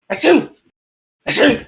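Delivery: squeaky high sneezes; tiny high sneezing; high-pitched sneeze. Acted. DM voices a character sneezing.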